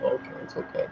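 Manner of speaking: hushed, whispery reassurance; soft forgiving. Retold or imitated. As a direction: give in to the kids under your breath. Acts out himself forgiving the kids.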